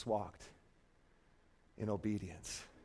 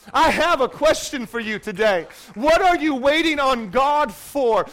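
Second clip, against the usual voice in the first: usual speaking voice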